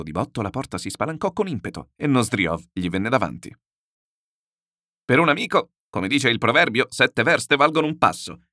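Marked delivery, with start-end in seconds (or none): 5.0-8.5 loud, hearty entrance; raised voice, greeting a friend; calling out. A narrator's reading. Nozdriov bursts in on his friend, calling out. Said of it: "gridò togliendosi il berretto".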